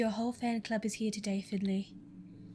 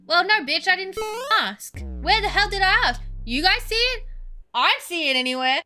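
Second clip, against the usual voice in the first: loud, high sassy outburst; loud mock rant; 8 semitones higher, 7 dB louder than usual. Real, not acted. Streamer heckles the chief, loud and high. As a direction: yell it back at her, sassy.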